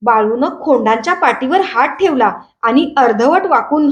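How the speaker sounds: usual speaking voice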